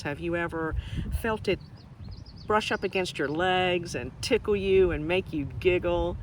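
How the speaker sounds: ordinary delivery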